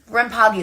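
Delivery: Rampage said ram-PAH-gee; playful mispronunciation. Shown as a demonstration. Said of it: "that's the way I like to say it".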